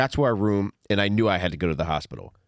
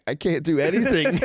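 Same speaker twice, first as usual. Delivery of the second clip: imitating crying; 9 semitones higher, 3 dB louder than usual. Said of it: "crying to my wife saying".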